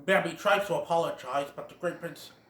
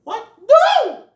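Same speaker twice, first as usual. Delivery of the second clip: much higher, much louder squeal of disbelief; shocked Bambi; 14 dB louder than usual. Retold or imitated. Bambi reacting to the taunt, shocked squeal. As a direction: shriek it in alarm. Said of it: "Bambi's all like".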